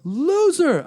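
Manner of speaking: retold heckle, pushed harder; heckler yell. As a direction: bellow the insult across the room. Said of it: "a guy in a bow tie yelling".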